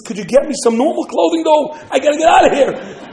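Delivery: loud, high, hurried-to-leave plea; urgent request. Acted. General asking his rescuer for clothes, urgent.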